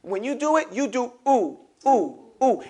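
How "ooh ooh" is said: ooh with lips rounded. Shown as a demonstration. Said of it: "put your lips consciously together".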